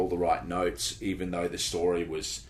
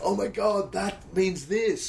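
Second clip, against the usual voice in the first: gushing superfan voice, high-pitched; mock a thrilled fan, drawing the words out; excited fan; 11 semitones higher, slower than usual. Imitating Halo fans who spot every reference, high-pitched gushing. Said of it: "people who are into Halo are like".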